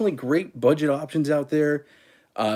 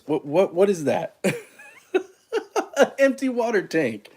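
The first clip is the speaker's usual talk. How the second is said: laughing through the words at his own mistake; giggling in disbelief, words breaking into laughter; 8 semitones higher than usual. Real, not acted. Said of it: "I'm laughing right now".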